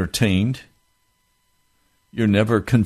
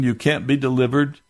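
usual speaking voice

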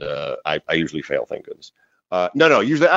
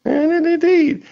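mock grand reply; slower than usual. Slow high mock-grand reply.

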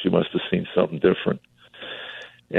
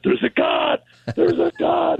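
retold joyous shout. Telling his buddy on the phone, ecstatic shout. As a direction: burst out with the news like a revelation. Calling friend after conversion, overjoyed.

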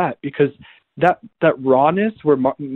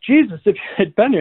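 higher-pitched mock accusation; imitated angry reproach; 6 semitones higher, faster than usual. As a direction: act out Mary's reproach to Jesus. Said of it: "You can hear the anger in that".

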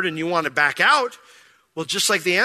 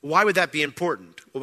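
ordinary delivery

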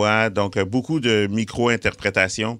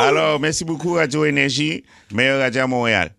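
put-on higher-pitched sign-off in a Haitian accent; 4 semitones higher than usual. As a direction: thank the station with a mock Haitian accent. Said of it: "quand vous parlez en haïtien". (accent haïtien aigu, remerciements pour rire)

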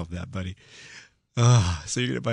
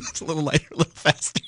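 high-pitched, giggly imitation of a gadget fan; amused mock sales points; tickled, mocking quote; 10 semitones higher, faster than usual. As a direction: quote it while fighting back laughter. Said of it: "It tickles me".

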